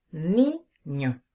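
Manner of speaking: niño split slowly, force on ni; niño with a strong first syllable, then a separate ño; stress on NI. Shown as a demonstration. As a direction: draw out niño as NI... ño. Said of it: "La fuerza de la voz está sobre la ni".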